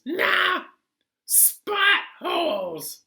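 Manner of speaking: high, loud cartoon passenger, slow and sure; odd passenger voice. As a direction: squeak it out like a goofy know-it-all. Passenger insisting.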